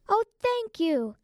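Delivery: high, drawn-out "thank you"; grateful exclamation. A narrator's reading. Rabbit learns it will go back to the garden, high grateful exclamation. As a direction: exclaim it high, full of relief. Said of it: "the rabbit exclaimed".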